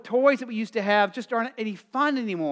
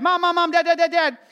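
put-on high, loud nagging kid calling for a parent; pestering child; 10 semitones higher, 8 dB louder than usual. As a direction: tug at the parent's sleeve, over and over. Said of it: "how many of you teachers ever had, you know, like, students do that?".

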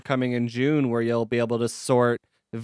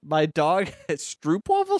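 ordinary talk, nothing marked

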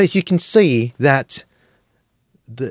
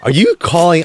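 ordinary talk

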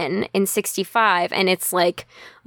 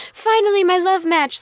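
Mary swooning over her new husband; 9 semitones higher than usual. Acted. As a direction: gasp it like a lovestruck teen queen. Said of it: "Mary is just, like".

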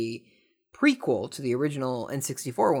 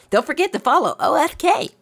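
much higher, louder announcer-style plug; chirpy sponsor plug; 13 semitones higher, 6 dB louder than usual. Acted. Vlogger breaks off to plug his channel in a squeaky promo voice. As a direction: pitch it way up like a cheesy ad read.

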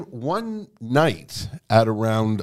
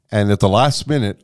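ordinary delivery

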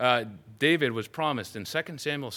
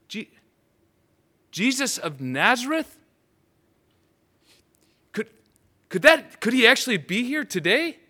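stunned hope; 9 semitones higher, 6 dB louder than usual. Acted. Bartimaeus hears Jesus's name.

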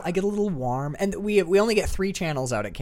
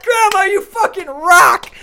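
much higher and louder, slow, laughing; laughing shout; 11 dB louder, slower than usual. Retold or imitated. Grandson cheering his grandma, laughing loud shout. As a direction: cheer her on through a laugh.

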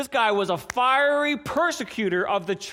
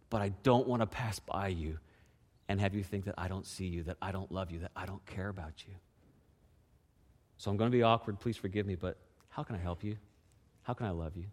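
soft tender plea; 12 semitones lower, 10 dB quieter than usual. Acted. Softening plea to hurting friend.

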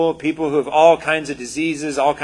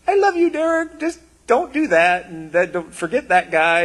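high, lilting voice of a kind God; God's loving voice; 4 semitones higher than usual. Retold or imitated. God gently correcting Derek, a high, fond voice. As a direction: let it float up high, fond and light. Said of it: "you were just like".

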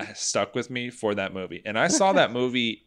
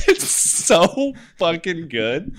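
giggling through the words, slowly; laughing; 5 semitones higher, slower than usual. Real, not acted. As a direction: laugh while you say it. Laughing hard over fart video.

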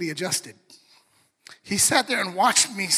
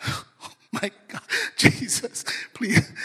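terrified whisper. Retold or imitated. Terrified teen caught by dad.

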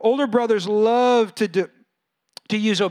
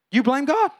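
ordinary talk

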